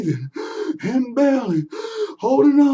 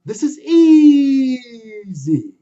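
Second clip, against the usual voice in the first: drawn-out "easy" with a louder straining cry, very slow; 5 semitones higher, 9 dB louder, much slower than usual. Acted. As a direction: push the word out with effort. Anansi straining up tree.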